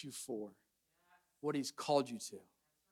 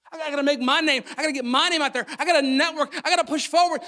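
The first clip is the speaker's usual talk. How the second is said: mock striving; 6 semitones higher, 5 dB louder, faster than usual. Mimicking self-promoters.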